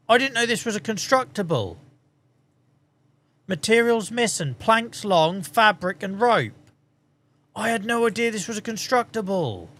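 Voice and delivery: real astonishment at finding something new; genuinely surprised. Real, not acted. Discovers unknown constructible in game.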